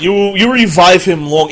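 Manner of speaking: ordinary talk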